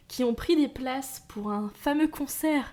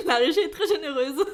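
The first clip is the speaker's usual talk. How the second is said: en riant; 5 semitones higher, 6 dB louder than usual. Real, not acted. Plaisante sur les cadeaux.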